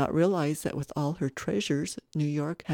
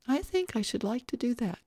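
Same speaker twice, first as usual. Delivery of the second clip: put-on wistful little boy; 8 semitones higher than usual. Little boy gazing at the soldiers, wistful. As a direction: venture it as a shy wish.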